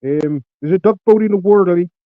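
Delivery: put-on Philly accent. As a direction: ham up a Philly accent, calling out about the duck boat. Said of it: "can I do the Philly accent?".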